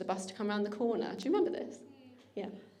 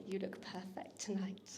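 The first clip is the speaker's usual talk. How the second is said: softly whispered, much softer; whispered line; 3 semitones lower, 12 dB quieter than usual. Acted. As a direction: breathe it like a secret to the couple. Romantic lyric ending the poem.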